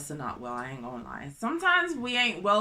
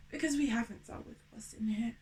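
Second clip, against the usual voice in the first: unvoiced whisper; 8 dB quieter, faster than usual. Real, not acted. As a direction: lean in and whisper it.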